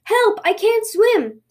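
high-pitched storybook cry for help; panicked cry. Acted. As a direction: call for help while sinking. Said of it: "cried the tanuki".

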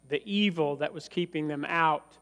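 ordinary talk

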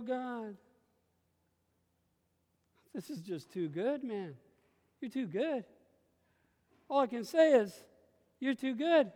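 usual speaking voice

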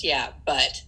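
low, skeptical yeah but; dismissive yeah but. Shown as a demonstration. Coach shows a dismissive tone to avoid. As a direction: brush them off with a doubting yeah, but. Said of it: "a tone in your voice that says".

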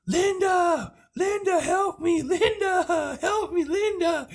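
imitated high-pitched scream for help; Handy. Pinned niece calling downstairs to her aunt, imitated screaming. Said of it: "I can hear Handy screaming".

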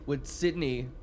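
ordinary talk, nothing marked

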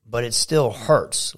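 ordinary delivery

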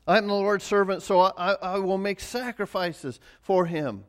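fervent sermon climax; preaching at full force. Acted. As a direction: preach it like a rising vow of service. Preaches Mary's vow at full force.

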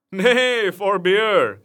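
a hint of breathless laughter; laughing cry. A narrator's reading. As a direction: halt the men while laughing. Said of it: "cried Robin, laughing until his sore sides ached".

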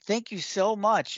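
high, squeaky goblin gushing thanks; a little goblin fawning over his new master; grateful goblin. Acted. Goblin thanks the bard as master, high and squeaky. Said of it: "and he says".

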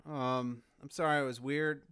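normal talking voice

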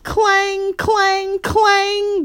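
high, ringing bell imitation; dinner bell. A narrator's reading. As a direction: sustain each word high like a struck bell. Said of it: "went the dinner bell as Mama shook it".

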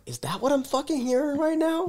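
high, incredulous outburst; outraged. Retold or imitated. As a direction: squeal it in disbelief. Outraged call over the walkie.

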